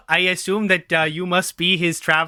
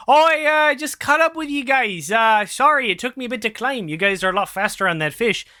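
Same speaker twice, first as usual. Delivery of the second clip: put-on high, bright-eyed Minnesota accent, apologetic; a nervous Minnesotan catching up; 6 semitones higher than usual. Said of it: "[name] sounds like he's from Minnesota".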